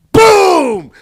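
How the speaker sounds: very loud, high blast of a word; explosive boom. Acted. Comedian as the boat blows up, explosive shout.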